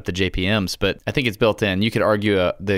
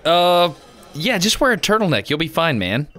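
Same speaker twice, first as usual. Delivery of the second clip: high bartender voice; 9 semitones higher, 4 dB louder than usual. Pretend. Bartender character replies.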